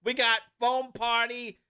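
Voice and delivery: mock salesman patter in Australian-Jersey accent. Executive announcing new games to fans in an Australian-Jersey accent. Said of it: "Australian Jersey accent".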